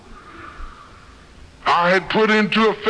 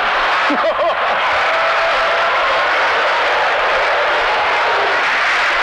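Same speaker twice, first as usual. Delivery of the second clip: big breathy laugh, rising; 8 semitones higher than usual. Real, not acted. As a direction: burst out laughing high. Crowd-pleasing laugh after joke.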